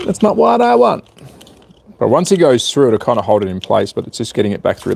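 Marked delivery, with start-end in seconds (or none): 0.1-1.0 high mock sing-song, "That's not what I want" lifted up high. Repeats line in sing-song voice.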